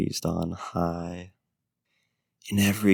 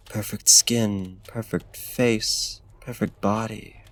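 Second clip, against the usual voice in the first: ordinary delivery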